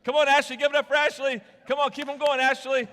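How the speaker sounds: loud, high cheering, calling her on; cheering shout. Real, not acted. Pastor cheering volunteer Ashley as she juggles balloons, loud and high. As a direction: whip up the crowd for her.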